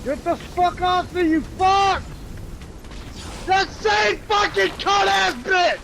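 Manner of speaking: rage-yell at the enemy; swearing tirade. Real, not acted. Raging at enemy in game.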